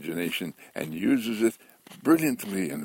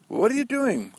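very high caller's question; curious caller; 3 dB louder than usual. Retold or imitated. Caller phones Walter Mitty again, voiced very high. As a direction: pitch the question way up, playfully curious.

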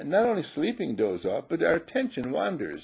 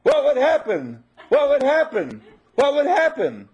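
shouted question; 12 semitones higher, 8 dB louder than usual. Shown as a demonstration. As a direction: shout it out to wake the room. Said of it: "I shouted this amount of time".

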